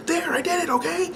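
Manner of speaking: high, tearful retold yell of a mad child; imitating son's teary outburst; mock angry kid. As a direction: shout it back like a furious, crying seven-year-old. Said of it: "yelled in my face".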